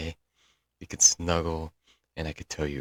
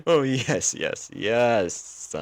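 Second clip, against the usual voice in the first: higher, louder, rapid happy yeses; delighted yes; 6 semitones higher, 4 dB louder, much slower than usual. Acted. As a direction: gush with giddy joy. Delighted at the offered coffee date.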